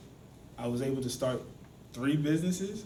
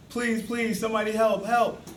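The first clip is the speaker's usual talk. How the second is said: high pleading cry, only somewhat louder; mock screaming; 6 semitones higher, 4 dB louder than usual. Joe still stuck hours later, begging passers-by, mock screaming. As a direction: plead for rescue in an acted, half-raised scream. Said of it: "Joe starts screaming again".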